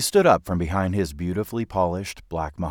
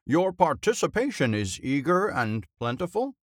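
high, formal oration; pompous headmaster; 7 semitones higher than usual. A narrator's reading. Drinking headmaster formally addresses pupil on the stage, pompous. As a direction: address the boy grandly and formally.

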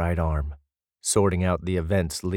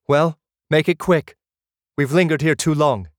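higher, louder, impatient sister; 9 semitones higher, 5 dB louder than usual. A narrator's reading. Sister tells the group to hurry out of the cave, louder and impatient. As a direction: push them out the door, irritated.

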